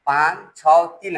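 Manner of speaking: "पाँच छः तीन" slowly, one digit at a time; slow, separate digits in "पाँच छः तीन". Shown as a demonstration. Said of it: "ऐसे पढ़ा जाता है".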